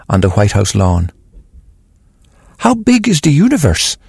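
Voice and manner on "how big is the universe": put-on child voice. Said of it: "she was asking him".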